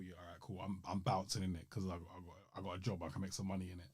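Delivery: lightly voiced careless dad; offhand dad. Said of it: "tells Uncle Phil".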